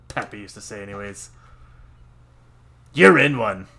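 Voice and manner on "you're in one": loud teasing jab; loud punchline. Acted. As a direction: blurt it out loud. Loud joke line.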